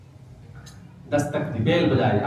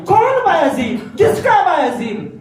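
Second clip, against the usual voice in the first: sharp mother; 5 dB louder than usual. Acted. Mother refusing her son through the door, sharp and loud. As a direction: reject him sharply, not my son. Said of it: "بڑے تیکھے لہجے میں آواز آئی".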